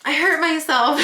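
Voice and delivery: retold complaint tipping into a laugh; laughing mock whine. Storyteller quoting her hurt self to husband, laughing mock whine.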